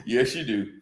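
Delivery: low teasing reply. Retold or imitated. Quoting Shannon's answer to the woman, low laughing mimic. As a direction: tease it low like Shannon did. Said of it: "when Shannon says".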